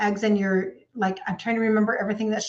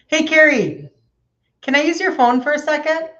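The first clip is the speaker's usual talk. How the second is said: higher and louder call across the room; 6 semitones higher, 6 dB louder than usual. Real, not acted. Calling to Carrie for her phone.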